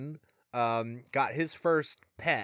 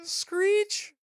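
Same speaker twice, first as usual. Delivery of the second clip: very high-pitched screech; mock record scratch. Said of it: "record break, or scratch, or whatever".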